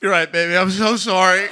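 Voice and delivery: put-on cowed, contrite boyfriend; submissive apology. Boyfriend caves to his girlfriend in the debate, submissive apology. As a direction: meekly give in and apologize. Mock-abject apology after being berated.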